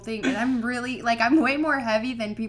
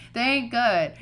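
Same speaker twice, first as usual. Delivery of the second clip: usual speaking voice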